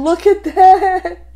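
high, loud, drawn-out whine of delight; stretched-out, tearful-sounding awe; adoring squeal. Real, not acted. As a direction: gush as if about to cry. Shows off the gorgeous palette.

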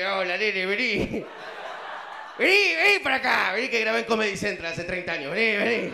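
loud, hammed-up clown beckoning a kid; train clown voice. Comedian imitating a sad train clown calling a child, hammed-up and loud. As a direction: lure the kid over like a washed-up train clown. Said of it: "Con esa voz de payaso de tren".

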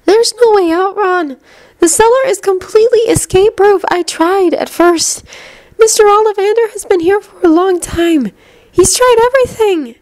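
put-on high-pitched girl's character voice; Luna, explaining. A narrator's reading.

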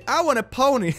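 very high pretend kid voice; child's voice. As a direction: squeal it like an eager child. Voices child answering parent.

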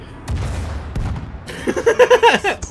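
high-pitched giggling; high giggle. Real, not acted. Laughing at something found.

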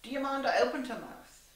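usual speaking voice

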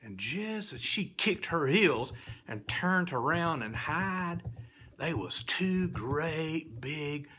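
spooky storyteller's reading, swinging from whisper to loud; theatrical recitation for children; dramatic poem recitation. A narrator's reading. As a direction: tell it like a fireside ghost tale.

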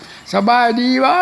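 high, slow, drawn-out shouted hello; mock loud greeting. Old monk greets a supposedly deaf visitor with a slow shout. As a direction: bellow it slowly as if to a deaf man. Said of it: "หลวงพ่อต้องพูดเสียงดังๆนะ".

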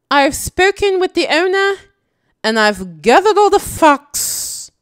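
high, loud mock English accent; hammed-up English accent, drawn out at the end; imitated English accent. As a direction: declare it grandly in a fake English accent. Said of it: "imitating these, uh, th-, her accent".

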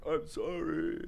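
put-on small, sheepish child's apology; sheepish kid. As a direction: play the toddler saying sorry. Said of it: "I was like".

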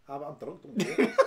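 giggling through the words, a squeal of laughter in the middle; laughing at his own slip; half-sheepish laughter. Real, not acted. Drunk admission, laughing.

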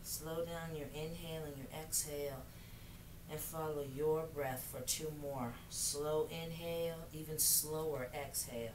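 soft, calm meditation voice; guided meditation. Acted. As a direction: guide them gently into stillness. Leads yoga practice softly.

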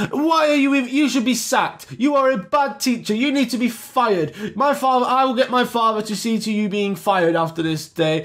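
high-pitched mock indignation; outraged rich kid. Rich kid threatening the PE teacher with sacking, mock outraged. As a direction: spoilt kid demanding heads roll, hammed up. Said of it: "he's having none of it".

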